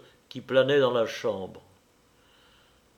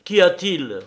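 louder, higher, insistent question; frowning repeat; 5 semitones higher, 6 dB louder than usual. A narrator's reading. Man repeating his question, stern demand. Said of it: "répéta-t-il en fronçant les sourcils".

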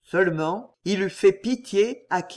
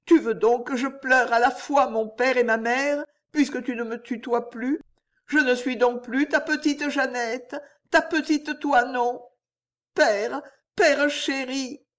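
put-on higher girl's voice, choked and pleading; stifled, tearful plea; 6 semitones higher than usual. A narrator's reading. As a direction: beg him tenderly, near tears, slowing at the end. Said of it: "d'une voix étouffée". (Jeanne supplie son père, voix aiguë et étouffée)

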